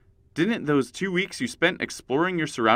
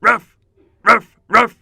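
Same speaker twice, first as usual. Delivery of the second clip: dog barking; 8 semitones higher, 12 dB louder, slower than usual. Shown as a demonstration. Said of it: "And a few more".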